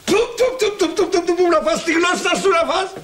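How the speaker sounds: flustered tut-tut warding off the evil eye; old uncle startled into a charm against ill omen; superstitious alarm. Acted. Uncle wards off talk of death.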